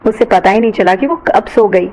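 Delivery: ordinary delivery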